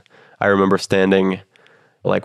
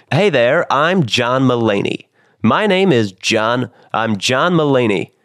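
higher, slower, drawn-out comedian voice; slow and deliberate, savouring each name; John Mulaney impression; 4 semitones higher, slower than usual. Showing the host how his comedy idol sounds, doing John Mulaney. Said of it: "his voice is like".

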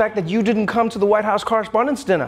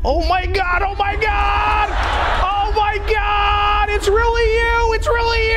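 starstruck shriek; 12 semitones higher than usual. Pretend. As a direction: squeal like a starstruck fan. Covid starstruck meeting Fauci.

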